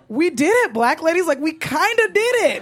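high, delighted victory announcement; mock triumph. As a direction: celebrate to your people, laughing at the end.

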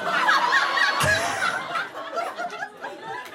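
squealing high laugh. Real, not acted. Big laugh.

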